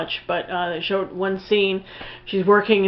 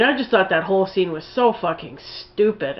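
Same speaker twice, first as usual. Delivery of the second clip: ordinary talk, nothing marked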